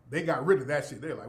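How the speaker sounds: ordinary delivery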